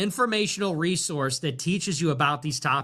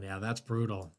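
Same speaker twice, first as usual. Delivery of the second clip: usual speaking voice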